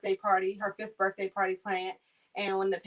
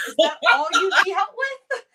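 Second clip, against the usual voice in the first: louder, higher incredulous question; surprised reply; 10 semitones higher, 9 dB louder than usual. Retold or imitated. Caregiver answering the client's small request, surprised. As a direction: sound amazed it's so little. Her own quoted reply.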